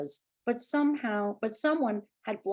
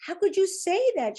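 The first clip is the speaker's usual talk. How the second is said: high, loud anguished wail; mother howling at her son, high and loud; 11 semitones higher, 6 dB louder than usual. A narrator's reading. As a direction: howl the question, much higher and louder. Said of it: "she howled".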